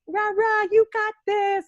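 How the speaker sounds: high, peppy mock encouragement; mock cheerleader. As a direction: parody a cutesy cheer, no yelling. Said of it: "instead of someone who's like".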